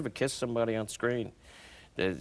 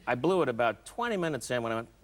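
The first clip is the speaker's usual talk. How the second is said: ordinary delivery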